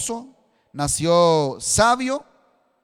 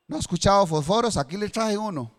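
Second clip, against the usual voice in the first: ordinary talk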